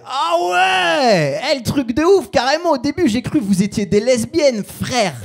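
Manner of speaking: high, drawn-out shout easing into loud exclaiming; mock hilarious astonishment. Passer-by mocking the comedian and girlfriend, high amused mock shout. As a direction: imitate a bloke crowing in amused disbelief. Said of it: "le mec il nous regarde mort de rire".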